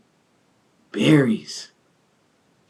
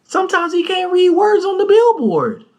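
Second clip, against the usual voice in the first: mock high teasing. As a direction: squeal the tease way up high. Teasing the friend's reading.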